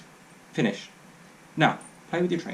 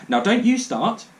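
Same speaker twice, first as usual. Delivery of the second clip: louder and higher, sharp rebuke; mother snaps at her son; snapping; 6 semitones higher, 6 dB louder, slower than usual. Acted. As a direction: cut the boy off crossly.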